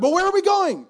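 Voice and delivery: put-on high, loud worried kid voice; a small boy demanding to know where they are going; anxious little boy. Boy in the car asks his parents, high and anxious.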